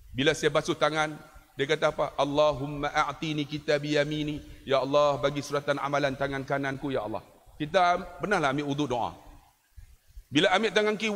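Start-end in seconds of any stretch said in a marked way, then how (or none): 2.2-7.2 murmured prayer. Retold or imitated.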